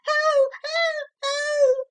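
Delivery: put-on very high, little boy crying out; child's wail. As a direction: wail like a terrified small boy. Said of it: "screamed the small sufferer".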